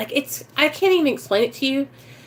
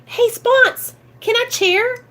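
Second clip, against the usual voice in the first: high, eager ask; eager newcomer asking; 11 semitones higher, slower than usual. Pretend. Newcomer eagerly asking her sponsor to chair. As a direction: pipe up hopefully to the sponsor.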